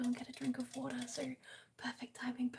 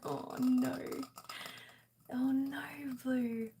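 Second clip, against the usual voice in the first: mock dismay; 5 dB louder, slower than usual. Real, not acted. Sympathetic loud reaction.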